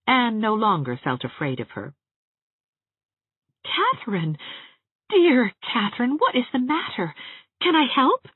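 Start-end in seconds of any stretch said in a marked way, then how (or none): 3.6-8.3 concerned. Acted. Anne comforting crying Catherine.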